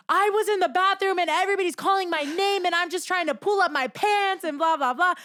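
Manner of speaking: high-pitched mock tirade; retold pissed-off complaint. Friend storms out of the bathroom complaining, mock annoyed rant. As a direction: imitate an angry friend's rant. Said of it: "she's pissed".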